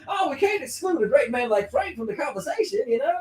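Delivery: high, put-on Texan gentleman; [name]'s Texan tycoon. Tycoon steering his date's talk to Frank, hammy Texan accent. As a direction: ham up a fake Texan accent.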